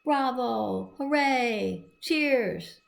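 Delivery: bright cheering crowd calls. Acted. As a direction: call out bravo like an audience.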